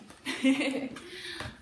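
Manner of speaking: a short breathy laugh. Real, not acted. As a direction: laugh lightly at yourself. Laughs after saying she loves bread.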